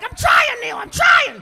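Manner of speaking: high, very loud panicked yell; desperate pleading. Acted. Cornered debtor pleading with dad, desperate yelling. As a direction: shout back like a cornered debtor.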